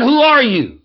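high, loud indignant demand; angry woman snaps back at the preacher; angry lady. Retold or imitated. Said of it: "Boy, she was getting angry".

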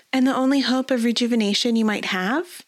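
high, playful noble lady; teasing lady. A narrator's reading.

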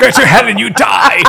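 hammed-up shouted curse, laughing; mock fury. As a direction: yell it half laughing. Joking fury at bolo-tie fans.

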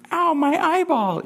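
family running-joke squeal, high and drawn out; jokey high-pitched yelp; mimicking high voice. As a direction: imitate a cartoon kid, very high and slow. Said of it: "Ralphie with this high voice".

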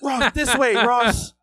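loud, high call to steer someone. Retold or imitated. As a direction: holler as if herding a drunk friend. Directing a drunk friend into house.

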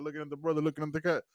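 normal talking voice

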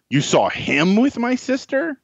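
"him" punched with a leap up in pitch, held high to the end; incredulous question landing hard on "him"; stress on "him". Shown as a demonstration. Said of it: "three very different sentences".

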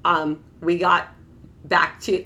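ordinary talk, nothing marked

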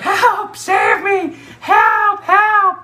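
very high, shrill-pitched mock cries for help; little sister screaming help from the tree; sister yelling for help. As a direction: scream for help like a kid tied up. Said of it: "my sister's like".

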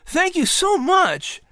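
put-on a boy overflowing with gratitude; excitedly. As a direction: gush the thanks slowly, overjoyed. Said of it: "Patrick said excitedly".

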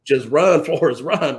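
loud, laughing call to run; laughing mock shout of encouragement; retold shout. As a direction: yell it like the Forrest Gump line. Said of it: "She's like".

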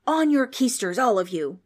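loud ghostly command; demanding. A narrator's reading. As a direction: order them all to sit. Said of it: "the ghost demanded".

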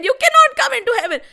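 high, loud, fast rebuff; scolding refusal. A narrator's reading. Gods push Trishanku out of heaven, scolding refusal. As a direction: fire it out to repel an intruder.

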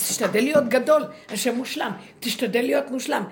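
loud, mocking push to be great; mock ego voice, loud and pushy; mock commanding voice. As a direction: bark the ego's demand, hammed up. Rabbi mocking ego's demand.

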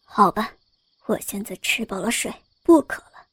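a lightly voiced, low sly scholar; deliberately. A narrator's reading. Scholar pretending to be done, low sly tease. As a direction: say it on purpose, low and teasing. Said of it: "故意说".

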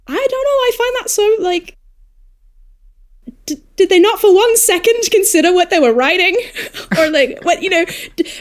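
can't believe anyone would write that, half laughing; exasperated disbelief. Real, not acted. Baffled by a reviewer's verdict.

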